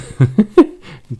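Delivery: high, bright burst of laughter; happy laugh. Real, not acted. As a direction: laugh out in relief. Relieved after finally winning.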